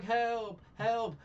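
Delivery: high, loud, hammed-up yells of "Help!"; mock cries for help. Victim in the movie calling for help, loud mock panic. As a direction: ham up a panicked call for help. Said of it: "and he's just like".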